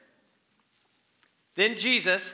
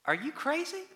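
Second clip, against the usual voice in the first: incredulous disciples; 9 semitones higher than usual. Retold or imitated. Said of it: "the disciples are in the boat going".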